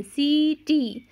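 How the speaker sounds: सीटी with the ई held very long; long ee in seeti. Shown as a demonstration. Teacher to pupils, drawing out सीटी's long ee. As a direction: stretch the ई in सीटी slowly. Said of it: "इसकी लंबी आवाज़ है".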